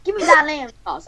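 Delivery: punchy swaggering demand; lightly voiced tough-guy caricature; Samuel L. Jackson. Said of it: "then Samuel L. Jackson says".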